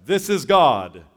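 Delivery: loud, slow booming voice from heaven; hammed-up heavenly voice; voice of God. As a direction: announce it like God from above. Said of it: "that voice comes from heaven".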